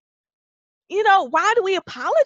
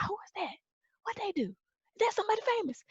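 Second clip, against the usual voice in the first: very soft, higher-pitched onlooker murmurs; hushed awe; 8 semitones higher, 11 dB quieter, much faster than usual. Acted. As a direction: murmur it like awed bystanders trading gossip. Acting out impressed onlookers.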